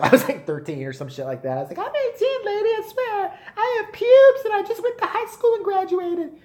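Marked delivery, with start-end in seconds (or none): 1.8-6.4 put-on high, pleading boy voice; squeaky kid voice. Thirteen-year-old begging the ticket girl, high squeaky plea. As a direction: beg like a kid faking his age, way up high.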